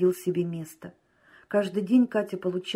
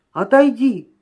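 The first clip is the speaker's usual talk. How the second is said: sharp raised rebuff; shouting; 4 semitones higher, 8 dB louder than usual. A narrator's reading. Drunken man rebuffing his friend, shouting. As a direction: push him away with a louder, higher cry. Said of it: "кричал Семечкин".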